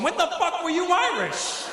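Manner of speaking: very high, drawn-out incredulous squeal; comic high-pitched outrage; exasperated disbelief. Acted. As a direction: squeal it slowly in mock disbelief. Exasperated punchline to Boston dialogue.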